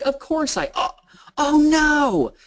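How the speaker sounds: high, rising alarm into a loud "oh no"; dismayed realization. Retold or imitated. Wife realizing her wedding ring is gone, high-pitched dismay.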